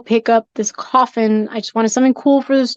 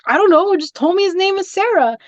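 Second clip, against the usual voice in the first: wife snapping out; 7 semitones higher than usual. Retold or imitated. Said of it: "like, snapped out".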